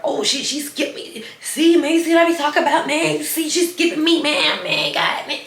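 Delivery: mock grumble. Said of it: "under her breath".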